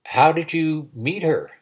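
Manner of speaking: usual speaking voice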